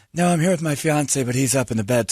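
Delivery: high put-on girl's voice; girl impression. Voicing the girl's answer.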